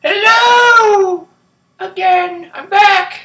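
high, loud cry of hello; shouted greeting. Real, not acted. Vlogger announcing a return to viewers, shouted greeting. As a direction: belt out a big high-pitched hello.

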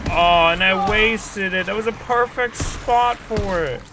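drawn-out, high, loud lament; loud frustrated wail. Real, not acted. Player who wasted the perfect spot, frustrated wail. Wasted his chance in game.

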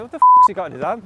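quick, loud baffled outburst; startled exclaim. Retold or imitated. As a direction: blurt out in shock. Acted startled inner quote.

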